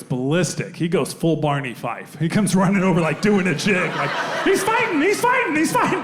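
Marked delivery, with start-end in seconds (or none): 4.4-6.0 panicky cop. Retold or imitated. Panicking cop, doing a jig.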